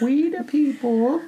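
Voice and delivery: put-on high, slow child reciting; tiny, sweet recital voice; cute little child. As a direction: imitate a small kid reading aloud. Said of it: "it was so cute".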